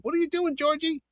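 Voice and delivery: high, scolding mother voice; mother's voice. Retold or imitated. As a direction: call him out like his mother. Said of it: "And the mother's like".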